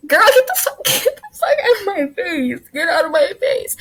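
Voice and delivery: high wailing outburst, half laughing; crying-laughing, high-pitched rant; tearful exasperation. Real, not acted. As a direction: wail it in comic despair, voice shooting up. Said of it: "I'm really about to cry".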